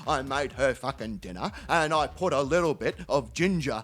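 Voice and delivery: very low, plodding character voice; upset mate Terry. Retold or imitated. Terry explaining the dinner to his mate, low plodding voice. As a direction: lay out each step low, pausing before the punchline. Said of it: "He goes".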